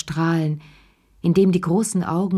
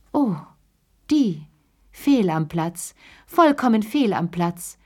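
scornful verdict, hammered home; merciless disdain; 2 semitones higher than usual. A narrator's reading. Mother judging the bride's mother, merciless disdain. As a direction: pronounce it with cutting disdain, building on the repeat. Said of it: "gnadenlose Augen funkelten".

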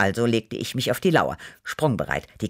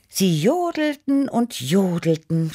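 high, drawn-out rapture; gushing; 7 semitones higher than usual. A narrator's reading. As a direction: draw it out high, raving about it. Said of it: "schwärmte ich". (schwärmerisch gedehnt)

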